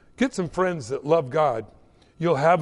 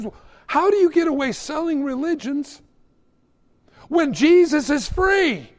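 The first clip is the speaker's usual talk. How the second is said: high-pitched, louder acted protest; incredulous protest, pitched way up; indignant challenge; 10 semitones higher, 4 dB louder than usual. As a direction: challenge the shopkeeper, slowing on the punchline. Customer challenges shopkeeper in skit.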